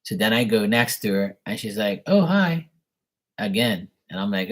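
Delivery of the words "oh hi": a high, light imitation of the woman's greeting; half-mock a flirty woman saying hi; lightly voiced woman. Man imitating the flirty woman greeting him, lightly raised voice.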